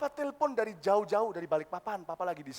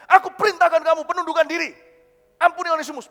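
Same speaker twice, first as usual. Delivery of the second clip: loud, high order; commanding; 5 semitones higher, 14 dB louder than usual. Acted. Paul ordering a church leader to forgive, commanding. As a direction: issue a forceful command.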